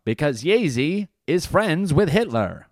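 high, slow hammed-up punchline; edgy comic punchline. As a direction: sell the shock line like a hack comic. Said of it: "It's like, uh".

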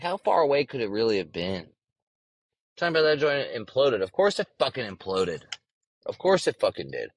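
ordinary talk

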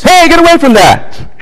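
high, loud urgent shout; loud warning voice. Shown as a demonstration. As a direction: shout it like a parent stopping a child at the stove. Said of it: "speak with a loud voice".

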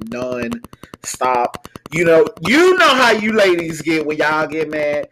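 ordinary talk

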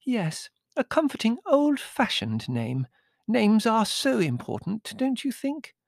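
put-on high, swooping little-girl voice; girlish gushing countess. A narrator's reading. Countess chatting to her new guest, girlish gushing. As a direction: gush sweetly, with musical leaps in pitch.

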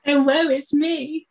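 slow, loud, high mock wail; dramatic lament. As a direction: ham up a tragic lament. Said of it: "really dramatic pose of".

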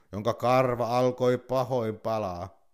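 plain reading voice

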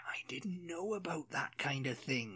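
whispered exaggerated Welsh; Welsh accent whispered. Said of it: "very exaggerated in the Welsh accent".

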